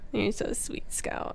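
very soft, near-whispered fondness; tender murmur. Real, not acted. Host doting on the cat Scout, tender and hushed. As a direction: coo it quietly, fading to a whisper.

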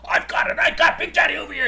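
shouting high and loud across the bar; mock shout. Big Daddy yelling his answer to the quizmaster. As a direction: yell it like a heckler in the crowd.

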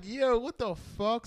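high, loud shocked outburst. Retold or imitated. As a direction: blurt it out stunned. Waking up shocked outside club.

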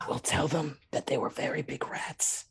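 hushed whisper. Retold or imitated. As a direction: whisper it conspiratorially. Whispered aside in story.

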